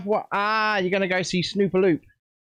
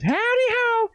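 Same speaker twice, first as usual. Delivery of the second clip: much higher squeaky cartoon cheer; Mr Hanky; slower than usual. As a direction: belt it like a squeaky cartoon mascot. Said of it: "It is Mister Hanky that goes".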